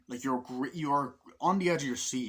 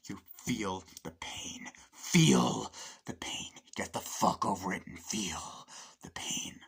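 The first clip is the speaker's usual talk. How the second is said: slow, whispered menace; sinister sergeant; slower than usual. Retold or imitated. Sergeant to wounded soldier, slow sinister whisper. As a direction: lean in and hiss it slowly. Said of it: "Sergeant Parris goes".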